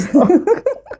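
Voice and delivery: laughing while near tears; short, high-pitched laugh. Real, not acted. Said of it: "saya bisa nangis".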